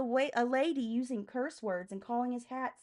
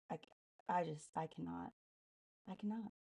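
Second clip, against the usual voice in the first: defeated sigh; 4 semitones lower, 11 dB quieter than usual. Real, not acted. Sighing, losing her mind.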